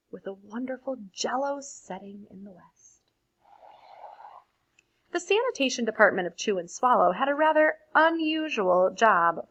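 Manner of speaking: put-on lively read-aloud for children; expressive storybook reading to a class; story narration. A narrator's reading. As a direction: read it like a bedtime picture book. Reads picture book aloud.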